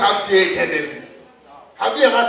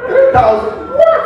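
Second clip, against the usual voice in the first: much higher and louder, outraged; shrill disbelief; 8 dB louder than usual. Real, not acted. Comedian outraged at the three thousand pound UK bond. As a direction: shriek it in disbelief. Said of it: "outraged disbelief".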